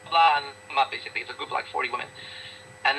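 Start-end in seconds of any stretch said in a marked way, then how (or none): 0.1-0.4 slow, louder mock groan about Monday; hammed-up Monday moan; mocking Monday whiners. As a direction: drag it out like a bored gym class. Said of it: "I said to them".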